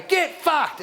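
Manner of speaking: high-pitched drive-by yell; mock Aussie yell. Driver to someone he passes, mock yell. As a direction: hurl it out of a passing car. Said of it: "very Aussie to yell at people".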